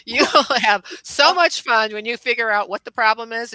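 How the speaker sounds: high, loud laughing mock welcome; playful laughing announcement; laughing through it. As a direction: giggle through a grand mock greeting. Jokey welcome speech while laughing.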